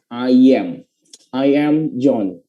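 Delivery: "I am" glided as "ai-yam", slowly; slow demo of "I-yam"; linking Y. Shown as a demonstration. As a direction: link "I" into "am" with a Y. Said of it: "ada huruf Y-nya".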